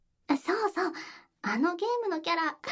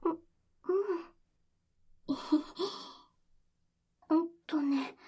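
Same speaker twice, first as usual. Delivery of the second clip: ordinary talk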